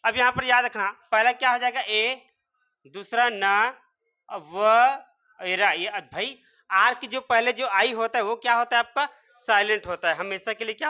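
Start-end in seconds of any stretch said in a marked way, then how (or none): none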